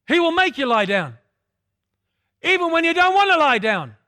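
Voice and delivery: loud preaching climax; preaching shout. Acted. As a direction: drive it home with a rising shout.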